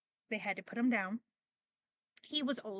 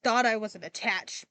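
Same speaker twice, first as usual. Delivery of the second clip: louder mock realization; retold outburst; 10 dB louder than usual. Grieving owner admitting attachment to the guinea pig, louder. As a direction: blurt it out, caught off guard. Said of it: "That I was like".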